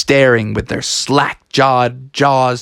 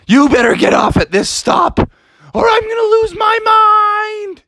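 much higher, loud angry yell; fed-up shout; 4 dB louder than usual. Acted. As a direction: snap at him to get off. Irritated monster orders him off.

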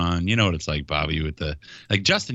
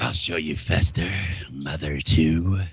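mock threat; 3 semitones lower, slower than usual. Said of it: "She's like".

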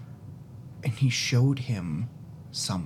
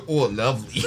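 mocking echo with a laugh; laughing imitation; 6 semitones higher, faster than usual. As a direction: repeat the line through laughter. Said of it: "Oksana in character".

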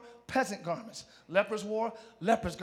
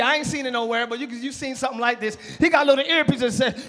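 ordinary delivery

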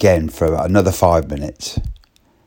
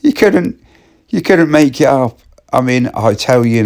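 still laughing; 8 semitones higher, 5 dB louder than usual. Real, not acted. Still laughing after punchline.

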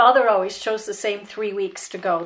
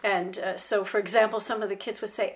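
normal talking voice

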